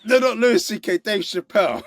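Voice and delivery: high, laughing backtrack; laughing correction. Real, not acted.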